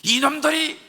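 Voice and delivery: high, loud outburst at underlings; angry rebuke. Acted. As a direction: roar at the servants as an offended master. Acted general rebukes his servants. (종들에게 버럭 호통치는 목소리)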